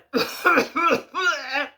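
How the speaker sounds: loud hammed-up cough; imitated cough. Bloke at the top of the road coughing, loud mock cough. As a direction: fake a big exaggerated cough. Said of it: "the bloke is going".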